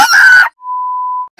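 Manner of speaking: sharp shocked gasp; loud high gasp. Real, not acted. Finds a dead body.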